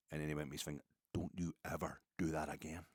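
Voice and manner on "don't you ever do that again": imitated quiet, kindly telling-off; paternal warning. Older fan handing back the scarf, paternal warning. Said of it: "I felt the kind of paternal".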